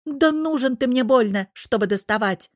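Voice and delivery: high, loud scornful start; indignant dismissal. A narrator's reading. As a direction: brush him off angrily. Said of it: "повторила Тереза".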